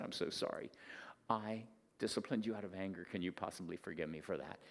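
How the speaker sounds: soft, pleading, hushed apology; begging forgiveness. Retold or imitated. Father begging his daughter's forgiveness, softly pleading. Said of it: "I begged her for forgiveness".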